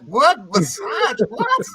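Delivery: stunned high disbelief, drawn out; incredulous. Retold or imitated. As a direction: say it like you can't believe it. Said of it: "I said".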